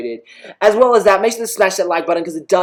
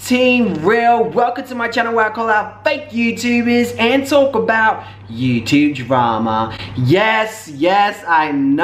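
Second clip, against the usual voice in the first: upbeat channel intro, pitched up; youtuber intro; slower than usual. Acted. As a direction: greet the viewers like a hyped vlog host.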